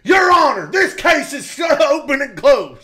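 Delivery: bellowed, high mock-lawyer oratory; Jim Adler in court. Lawyer addressing the judge, hammed-up shout. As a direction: thunder it out, drawing out the ending. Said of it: "picture him in court going".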